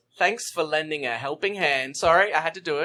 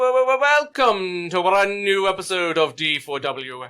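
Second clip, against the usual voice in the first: showy announcer welcome; 4 dB louder than usual. Acted. Host opening a new episode, showy announcer welcome. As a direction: announce it like a grand show opener. Host's hammed-up show opening.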